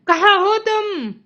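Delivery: very loud, high calling; desperate cry. A narrator's reading. Mother crying out for her lost son, high and loud. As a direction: call out desperately. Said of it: "चिल्लाया Mrs TV ने".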